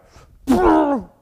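loud hammed-up throat clear; a comic pause before the dunk; hammed-up throat sound; mock throat-clearing shove. Mock dunking in the story.

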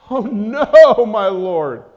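high, loud, slow cry of alarm; a servant wailing at the army outside; panicked servant. Acted. Servant seeing the army, crying out to his master in panic, high and loud. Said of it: "the servant asked".

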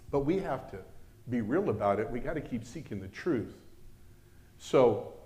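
ordinary delivery

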